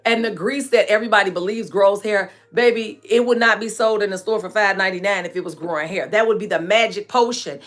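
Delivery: usual speaking voice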